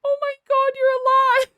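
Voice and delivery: overjoyed squeal at a returning friend; emotional surprise. Real, not acted. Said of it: "getting emotional all of a sudden".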